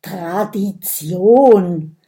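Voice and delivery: slow, dark grumble of the one word; grumbled. A narrator's reading. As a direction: mutter it sullenly, drawn out. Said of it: "brummt Wilde Hummel mit finsterem Blick". (finster gebrummt, langsam)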